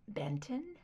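startled breathless whisper; whispered surprise. Acted. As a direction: breathe the name in shock. Surprised echo of name.

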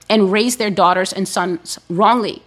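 ordinary talk, nothing marked

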